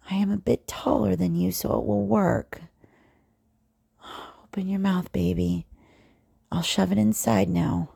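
ordinary delivery

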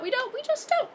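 very high-pitched brush-off; mock answer. Narrator refusing to explain the story, high mock voice. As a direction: pipe it up high to shut down the question.

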